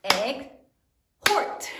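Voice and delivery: "Egg, hort" in two separate beats; syllable by syllable. Shown as a demonstration. Teacher counts syllables in exhort for third grade, syllable by syllable. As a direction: break "Egg, hort" apart with a clear pause. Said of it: "how many syllables are there in exhort".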